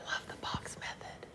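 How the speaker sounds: playful whispered aside; whispering. Acted. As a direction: whisper it like a secret. Teacher whispers aside about method.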